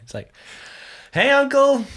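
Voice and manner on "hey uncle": high, loud phone hello; raised greeting. Acted. Nephew greets his uncle on the phone, high and loud. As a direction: call out a greeting in a lifted voice.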